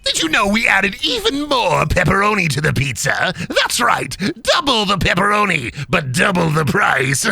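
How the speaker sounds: high manic villain salesman; wild theatrical pitch with cackles; Joker. Acted. Joker selling pizza to a customer, manic villain voice. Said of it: "Do the Joker selling us a Little Caesars pizza".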